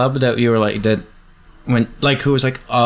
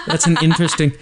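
laughing; 8 semitones higher than usual. Real, not acted. Laughs at the word pretend.

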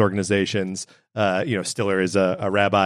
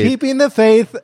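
excited; 12 semitones higher, 6 dB louder than usual. Real, not acted. Echoing the title with glee.

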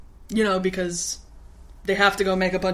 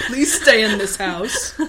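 slow, laughing plea; pleading dad; 2 semitones higher, 6 dB louder, slower than usual. Retold or imitated. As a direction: beg her to stay, slowly. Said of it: "he's like".

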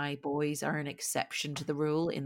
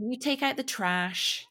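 higher-pitched mock American kid; imitating young son; 8 semitones higher than usual. Mum imitating her TV-watching six-year-old, mock American kid. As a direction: lightly mimic a child quoting American TV. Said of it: "So he says things like".

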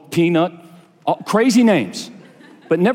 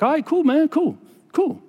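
high-pitched, hasty backing down; mock placating; 10 semitones higher than usual. Preacher backing away from the pizza shop men, mock placating. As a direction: defuse it, acting harmless.

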